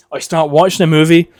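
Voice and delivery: usual speaking voice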